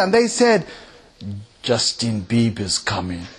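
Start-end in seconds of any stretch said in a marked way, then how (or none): 1.6-3.3 much lower, softer, slow deadpan reply; hotel staff reply. Retold or imitated. Hotel staff in Norway replying, low and deadpan. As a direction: answer like a weary hotel clerk.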